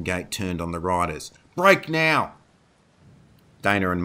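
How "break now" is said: loud, high, urgent battle order; shouted command. A narrator's reading. Sebastian orders riders to split before the horde, shouted command.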